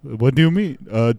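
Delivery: normal talking voice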